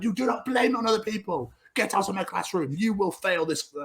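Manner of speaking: high mock teacher scold. Maths teacher throwing Tom out, high mock scold. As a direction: squeak it out like a cross teacher. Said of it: "teacher scolding in retold scene".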